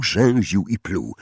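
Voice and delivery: ordinary delivery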